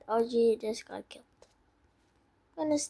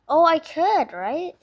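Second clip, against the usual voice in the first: ordinary talk, nothing marked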